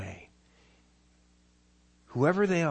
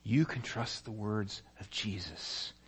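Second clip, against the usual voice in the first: much softer, slow gentle reassurance; soft inner voice; 5 semitones lower, 7 dB quieter, much slower than usual. Acted. Holy Spirit whispering to the father's heart, soft and slow. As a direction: speak it softly like a voice inside. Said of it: "the Holy Spirit whispers in his heart".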